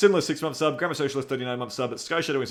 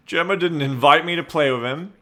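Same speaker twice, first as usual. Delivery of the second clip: mock whine; 4 semitones higher than usual. Mock-whining about not being invited.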